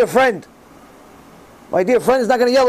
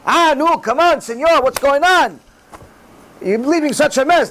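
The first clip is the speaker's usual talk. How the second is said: raised-pitch mock scolding; yelling janitor; 5 semitones higher than usual. Janitor scolding about the mess left behind, mock yelling. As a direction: complain like an exasperated janitor. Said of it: "The janitor yells at you".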